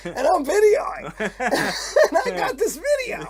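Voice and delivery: laughing. Real, not acted. Laughing through punchline.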